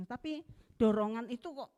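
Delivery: usual speaking voice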